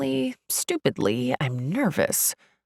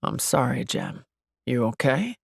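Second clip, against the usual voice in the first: low voice; 4 semitones lower than usual. A narrator's reading. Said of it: "he says in a low voice".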